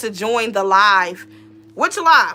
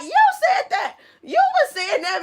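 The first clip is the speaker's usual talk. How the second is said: put-on high, excited kids' accusation; mock accusing kids; 14 semitones higher than usual. Kids insisting to their teacher, accusing. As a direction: throw it back at her, pitched way up.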